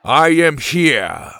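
slow, weighty announcement; thundering big man. Acted. Boom answering the group's call, thundering. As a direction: boom it out slowly like a giant. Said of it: "Boom thundered in his deep voice".

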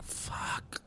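under-breath whisper; whispered curse. Retold or imitated. Spotting Mormons outside the house, whispered curse. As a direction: mutter it so they don't hear.

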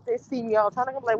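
normal talking voice

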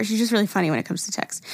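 normal talking voice

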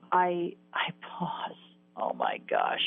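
breathy, tearful whisper, slowed right down; choked whisper. Real, not acted. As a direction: barely get it out, close to tears. Pausing at heaven's door, overcome.

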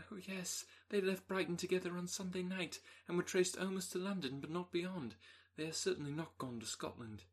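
put-on distressed young woman. Elizabeth answers Darcy in distress.

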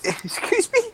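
high, drawn-out indignant excuse me; mock outrage. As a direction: act scandalised. Mock outrage at Star Wars insult.

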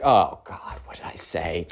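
low, soft and sinking; regretful mutter, dropping away; dismayed undertone. Retold or imitated. Carter realizing he messed up, dismayed under his breath.

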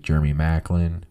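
ordinary talk, nothing marked

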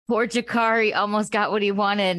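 retold pitying reaction; mock pity. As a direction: coo with exaggerated sympathy. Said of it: "I was like".

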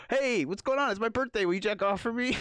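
high, loud, chirpy fake friendliness; sing-song creepy cheerfulness; cheery mock greeting. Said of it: "he would just be like".

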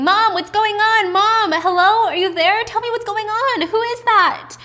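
high, loud panicked yelling into a phone; acted frantic screaming for an answer. Daughter screaming to her mother on the phone, high and panicked. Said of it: "still on the phone screaming".

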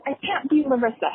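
high, loud cry of giving up; exasperated outburst. Retold or imitated. Mother at her fussy toddler, exasperated. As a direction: throw up your hands in defeat, voice jumping high. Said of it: "I just said".

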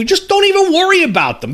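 exasperated plea. Real, not acted. Pleading with the author.

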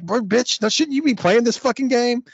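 put-on high, squeaky kid cussing; little kid voice. Little kid cussing at other players, high squeaky voice. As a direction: play a bratty little kid mouthing off. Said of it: "some little fucking kids".

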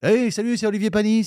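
light mock European French accent; lightly hammed-up driver greeting; imitating Olivier Panis. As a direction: play the racing driver on the phone. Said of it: "D'Olivier Panis".

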